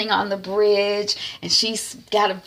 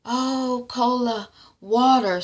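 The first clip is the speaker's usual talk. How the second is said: dazed plea; 5 semitones higher, slower than usual. Retold or imitated. Dazed woman mistaking him.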